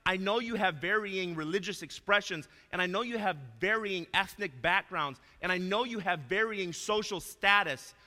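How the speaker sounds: loud, high pulpit voice building to a slow climax; impassioned sermon peak; preaching at full force. Acted. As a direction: thunder it from the pulpit. Preaches the Colossians message.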